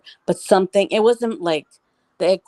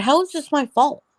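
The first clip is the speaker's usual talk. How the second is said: much higher-pitched, incredulous comeback; retold indignant protest; 9 semitones higher than usual. Mother answering her daughter's blame, indignant.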